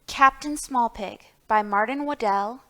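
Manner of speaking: plain reading voice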